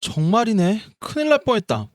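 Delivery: high startled gasp of relief; surprised. Acted. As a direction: jump up in surprise. Realizes food is forbidden.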